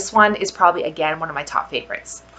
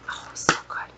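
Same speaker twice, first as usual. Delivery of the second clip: hushed whispered delight; 9 dB quieter than usual. Real, not acted. Sniffing oats and honey, whispering delight. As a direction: whisper it with pleasure.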